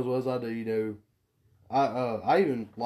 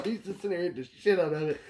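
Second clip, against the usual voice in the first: ordinary delivery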